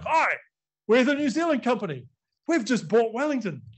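high, put-on greeting; imitated settlers. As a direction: lightly voice newcomers announcing themselves. Acting out newcomers' announcement.

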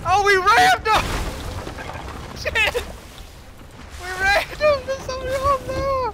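high excited shouts; excited yelling. Real, not acted. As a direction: yell it out, thrilled and shocked. Ramming a ship, excited.

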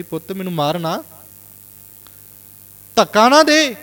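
a mother begging her son to stop; emotional pleading mother. Retold or imitated. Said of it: "ਭਾਵੁਕ ਹੋ ਕੇ ਸ਼ਬਦ ਬੋਲੇ". (ਭਾਵੁਕ ਹੋ ਕੇ ਤਰਲਾ ਕਰਦੀ ਮਾਂ)

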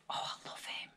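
soft unvoiced whisper of delight; hushed whisper to herself. Real, not acted. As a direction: breathe it like a secret. Whispering over new necklace.

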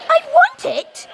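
high eager shout; high demand. Real, not acted. Demanding imposter.

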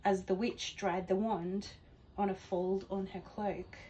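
ordinary delivery

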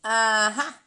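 loud, scornful witch's aha; the witch catching the prince, gloating; mocking aha. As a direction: jeer at him in triumph. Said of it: "rief sie höhnisch".

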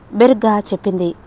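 usual speaking voice

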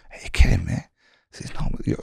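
a tense whispered comeback; whispered retort. Retold or imitated. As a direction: whisper it so the guards can't hear. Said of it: "this is like a whisper".

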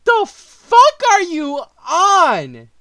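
drawn-out outraged shout, high and loud; the fuck are you on, screamed; incredulous yell. Real, not acted. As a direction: yell it slowly in disbelief. Reacting to diss track accusation.

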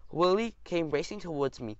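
ordinary delivery, nothing marked

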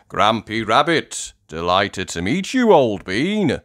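loud, hearty greeting; Mister Stallion. Acted. Mister Stallion meets his rescuer, loud hearty greeting. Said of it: "says Mister Stallion".

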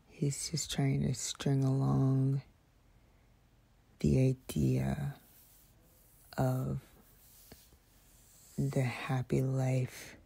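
hushed bitter whisper. Real, not acted. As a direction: whisper it close and slow. ASMR whisper rant.

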